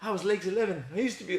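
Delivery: high Kiwi accent, mock wistful; Legs Eleven. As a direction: imitate a washed-up New Zealand champion. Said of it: "That's not a bad Kiwi".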